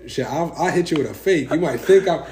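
loud, high, laughing boast; hyped-up play fighting brag; excited. Real, not acted.

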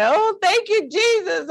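high-pitched mock wailing thanks; hammed-up grateful sobbing, way up high; ugly cry. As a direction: re-enact a tearful cry to Jesus. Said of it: "I was doing the ugly cry".

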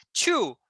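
Two with a Rio-style aspirated T, like "tchu"; carioca T demo. Shown as a demonstration. Teacher demonstrating a carioca T on Two. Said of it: "Pronto, é assim".

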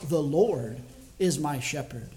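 "The Lord" weighted, then a pause before the rest; stress on THE LORD. Shown as a demonstration. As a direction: lean on "Lord" with a falling tone, pause, then lighter "is my shepherd". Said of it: "each time emphasize a different word".

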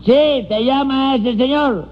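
high, slow call; shouting to the deaf. Acted. Repeating for a deaf man.

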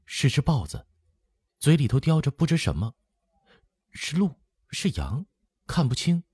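put-on high, thin frightened girl's voice; scared hushed report in a high voice; hushed, trembling. A narrator's reading. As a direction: tremble it out under the breath, pitched well up. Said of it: "低声颤颤地说".

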